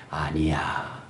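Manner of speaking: soft whispered no. Retold or imitated. Preacher voicing the Lord's soft whispered denial. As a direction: breathe the no gently.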